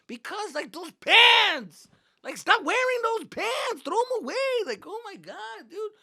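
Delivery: put-on high, whining teenage outburst; acted angry yelling. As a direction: stretch the complaint into a loud yell. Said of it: "Why are you yelling at me?".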